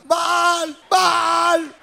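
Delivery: high, long-held calling chant; imitated priests' cries. Priests of Baal call their god, high mock chanting cries. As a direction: draw out the name like a mock invocation. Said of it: "y gritaban, y daban vueltas".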